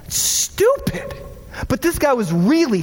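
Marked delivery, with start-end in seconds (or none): 0.1-1.1 slow, breathy, high scoff. Real, not acted. Pastor dismissing voting as a divide, high breathy scoff. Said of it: "dismissing voting distinction".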